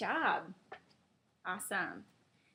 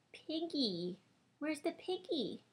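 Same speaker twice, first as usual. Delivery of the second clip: ordinary talk, nothing marked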